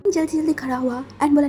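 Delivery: ordinary delivery, nothing marked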